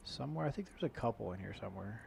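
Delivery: usual speaking voice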